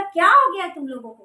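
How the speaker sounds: annoyed, half-shouted what's-wrong; irritated retort. Retold or imitated. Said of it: "चिढ़ के".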